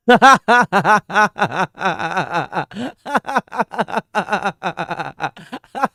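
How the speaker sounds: long, loud villain cackle; evil laugh. Acted. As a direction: throw your head back and cackle. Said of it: "This guy's so mean".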